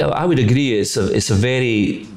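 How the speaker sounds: ordinary talk, nothing marked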